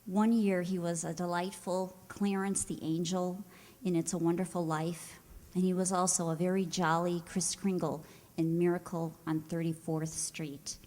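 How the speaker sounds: ordinary delivery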